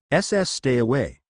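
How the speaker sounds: usual speaking voice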